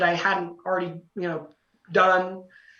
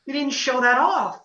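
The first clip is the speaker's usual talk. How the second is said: frustrated self-scolding; 8 semitones higher than usual. Retold or imitated. Singer scolding himself for skipping the high note, exasperated. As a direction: berate yourself in a raised, high voice.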